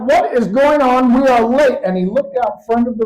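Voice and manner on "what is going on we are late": loud, high exasperated demand; frustrated Santa. Acted. Santa halted in midair and running late, loud exasperation. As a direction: burst out as an impatient Santa. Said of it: "ignored his frustration".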